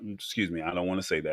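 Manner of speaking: normal talking voice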